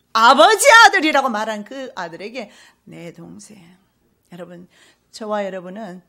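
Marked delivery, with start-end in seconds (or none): 0.1-2.5 a loud, higher burst; sudden loud preaching cry; sudden outburst. Real, not acted. Preacher bursts out mid-quote. 2.8-3.6 whispered, softer father's line; a father whispering "my brother" to his son; hushed father. Acted. Father speaks tenderly to son.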